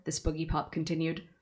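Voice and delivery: ordinary delivery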